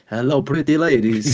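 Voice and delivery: put-on narrator voice. Host trying a narration voice for his co-host, hammed-up. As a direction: playfully try out a silly narration voice. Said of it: "you just get all husky".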